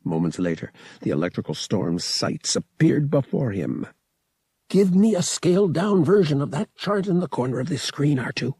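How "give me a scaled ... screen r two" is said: higher, lightly voiced command; ordering pilot. A narrator's reading. Pilot orders droid, lightly voiced command. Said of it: "Han ordered".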